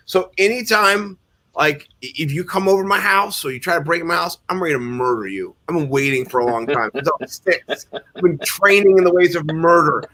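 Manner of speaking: usual speaking voice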